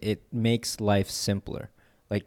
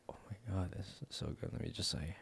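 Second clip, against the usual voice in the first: whispered inner monologue; very soft, as if sneaking a guilty pleasure; hushed secret delight; 13 dB quieter than usual. Acted. Acting out finding ASMR like a bad thing, hushed.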